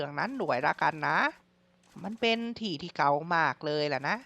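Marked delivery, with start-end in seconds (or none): none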